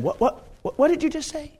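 stammered question rising high; mock disbelief, climbing in pitch; startled double take. Samson reacting to Delilah's threat, startled. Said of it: "he should have said".

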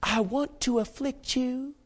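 high, soft, slow coaxing; Delilah. Acted. Delilah telling Samson her intent, softly seductive. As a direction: croon the threat in a put-on lady's voice. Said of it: "When she said".

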